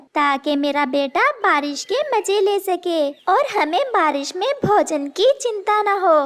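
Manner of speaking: usual speaking voice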